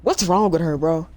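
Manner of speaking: loud disbelief. Real, not acted. Streamer yells in disbelief after the passenger runs off, loud. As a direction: yell it after her.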